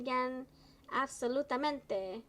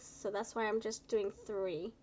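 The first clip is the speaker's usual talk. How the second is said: ordinary talk, nothing marked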